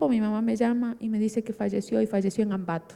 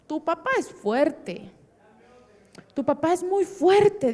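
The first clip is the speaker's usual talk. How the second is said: slow, higher-pitched firm encouragement; emphatic reassurance; 5 semitones higher, slower than usual. Retold or imitated. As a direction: insist slowly to comfort. Uncle firmly reassures crying niece.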